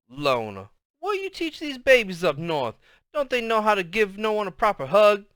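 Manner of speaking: a hint of a scolding granny; grandma voice.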